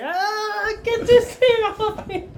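high, slow wail of pain; mock screaming. Trapped man begging to be freed, high pained screaming. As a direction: wail it like a hammed-up victim pinned down. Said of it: "he's screaming".

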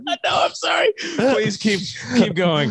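high giggly apology; trying not to laugh. Real, not acted. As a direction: still laughing while apologizing. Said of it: "I'm trying to be serious".

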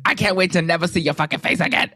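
high, heated reality-show quote; hammed-up angry parting shot; imitating Teresa's outburst. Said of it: "Teresa when she was like".

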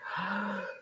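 a sharp demo intake of breath; demo breath gasp. Shown as a demonstration. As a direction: gasp in, savoring the air. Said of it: "I enjoy the idea that I could take a breath".

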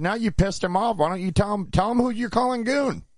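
very high, taunting challenge, retold; excited, high-pitched dare from a quarrel; heated goading. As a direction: egg him on, pitch soaring.

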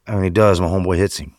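ordinary talk, nothing marked

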